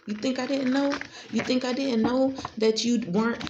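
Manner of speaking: normal talking voice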